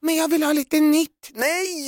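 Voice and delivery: very high, whiny mock plea; hammed-up nagging, pitched far up; pleading partner. Girlfriend wanting something new, high pleading whine. Acted couple quarrel over redecorating.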